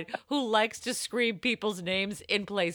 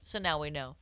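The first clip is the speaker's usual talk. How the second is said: usual speaking voice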